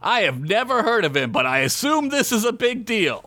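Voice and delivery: high, swooping theatrical gasp of a line; over-dramatic. A narrator's reading. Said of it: "Gary gasped over dramatically".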